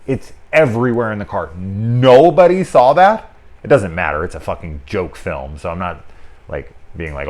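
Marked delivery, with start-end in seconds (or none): 1.5-3.3 loud, high and slow disbelief; nobody, loud and drawn out in mock outrage; incredulous. Real, not acted. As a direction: belt it out in disbelief. Incredulous remark about the film.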